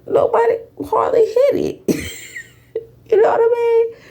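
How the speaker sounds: high amused laughing aside. Real, not acted. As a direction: giggle it out at her own expense. Laughing at her own empty Cash App.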